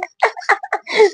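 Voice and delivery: a sudden high laugh that falls away; high burst of laughter. Real, not acted. Telling viewers about the ignored message, laughing high. Laughing at her own embarrassment.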